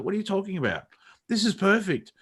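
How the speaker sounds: very high-pitched imitated protest; incredulous Zoe. Imitating stunt double answering the director, incredulous. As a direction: push it right up high, baffled. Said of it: "And Zoe's like".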